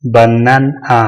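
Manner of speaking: बनन आ with a clear break before the last vowel; split into syllables. Shown as a demonstration. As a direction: separate बनन from आ. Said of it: "यहाँ पे हो गया".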